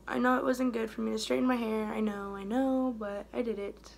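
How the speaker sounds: usual speaking voice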